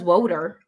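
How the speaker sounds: "Woder" with a long O in the first syllable; uncle's pronunciation of water. Retold or imitated. Quoting an uncle's word for water, "Woder" with long O. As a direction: say "Woder" like WOE-der. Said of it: "My uncle says Woder".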